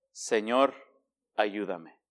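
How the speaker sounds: soft prayer. Shown as a demonstration. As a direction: breathe it out like a humble prayer. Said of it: "¿Sabe cuál ha sido mi mejor oración?". (súplica muy suave y baja)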